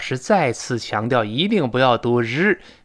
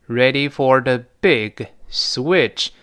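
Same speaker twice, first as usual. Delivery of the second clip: half-speed, word-by-word teaching read; deliberate slow English example; slow model reading; much slower than usual. Shown as a demonstration. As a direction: space out every word for learners. Teacher repeats line slowly for learners.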